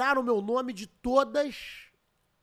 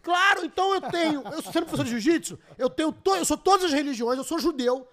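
excited boasting; 6 semitones higher, 7 dB louder than usual. Real, not acted. Boasting, laughing, loud.

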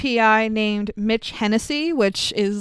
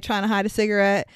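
usual speaking voice

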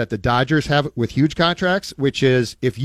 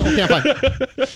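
laughing it off; 9 semitones higher than usual. Pretend. Recovers from mock heart attack.

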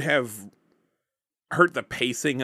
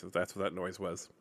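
ordinary talk, nothing marked

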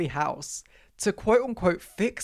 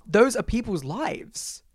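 mock anguish; 6 semitones higher, slower than usual. Outraged about deleted saves.

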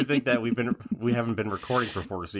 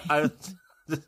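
trying not to laugh; 7 semitones higher than usual. Real, not acted. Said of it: "trying not to laugh".

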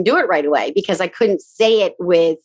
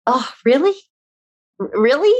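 higher, hesitant questioning with a stammer; retold dismayed disbelief; 6 semitones higher than usual. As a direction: balk at the advice in rising questions. Retold doubtful reply to friend's advice.

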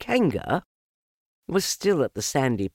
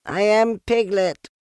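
high, loud insistence; squeaky piglet shouting; 13 semitones higher, 8 dB louder than usual. A narrator's reading. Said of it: "said Piglet loudly".

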